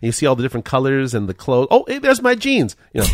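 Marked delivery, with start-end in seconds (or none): 1.7-2.8 high, delighted squeal; mock excited. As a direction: squeal at spotting the jeans. Excited at seeing clothes.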